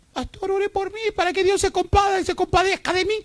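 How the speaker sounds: high whiny begging voice; mock a whiny plea for pity; hammed-up pleading member; pleading churchgoer. Pastor mocks a pleading congregant.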